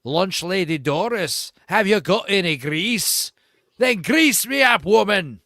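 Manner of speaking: a lightly done Groundskeeper Willie brogue; Groundskeeper Willie Scottish. Retold or imitated. Streamer showing a Scottish accent like Groundskeeper Willie. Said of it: "Scottish is more like".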